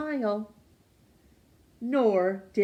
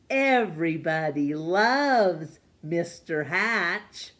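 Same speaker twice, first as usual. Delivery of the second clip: slow, loud, proud reading of loves; sign proclamation; 4 dB louder, slower than usual. A narrator's reading.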